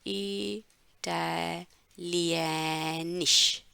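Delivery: drawn-out syllable by syllable इटालियनिश; slow model word. Shown as a demonstration. Teacher modelling इटालियनिश slowly for students. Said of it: "ठीक है, इटालियनिश".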